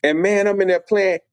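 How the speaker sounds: ordinary talk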